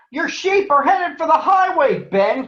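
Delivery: high, loud alarmed call; cried out. Acted. Cub warning farmer about runaway sheep, loud alarmed cry. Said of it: "cried Brother".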